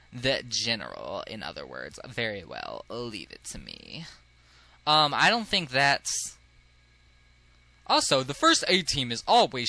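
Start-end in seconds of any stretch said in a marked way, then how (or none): 0.1-4.1 low, slow, lofty snob; low-voiced haughty noble; haughty aristocrat. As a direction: sneer softly down at everyone in a low drawl.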